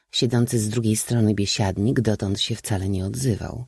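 usual speaking voice

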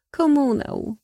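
usual speaking voice

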